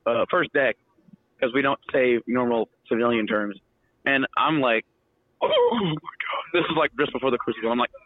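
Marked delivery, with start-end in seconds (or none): 5.4-6.4 slow, high whimpering "Oh my God"; mock whimper. Recruit desperate for the toilet, mock whimper. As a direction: moan it like you're about to burst.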